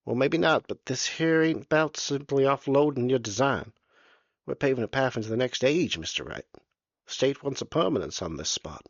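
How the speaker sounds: oily politeness of a small bureaucrat; fussy little official. Acted. Surveyor pushes the state's plan.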